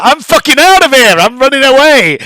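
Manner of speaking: high, loud mock panic; exaggerated cry of fleeing; retold panicked yell. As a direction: shout it like you're bolting in a panic. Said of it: "and I was like".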